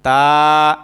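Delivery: তা held low and clear; model letter. Shown as a demonstration. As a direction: lead repetition of তা. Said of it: "আবার বলেন". (তা স্পষ্ট করে বলা)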